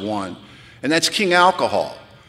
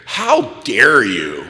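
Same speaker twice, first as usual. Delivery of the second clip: indignant mock outrage. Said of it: "I got right in his face".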